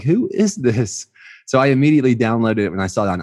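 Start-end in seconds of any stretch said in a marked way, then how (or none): 0.0-1.1 intrigued who is this. Retold or imitated. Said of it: "I'm like".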